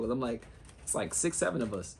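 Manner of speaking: usual speaking voice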